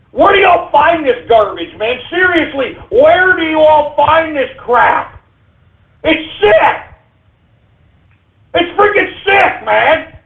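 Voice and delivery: high-pitched, drawn-out indignation; disgusted outrage. Real, not acted. Host berating callers for their garbage, disgusted outrage. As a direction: rant in appalled disbelief, voice pitched high.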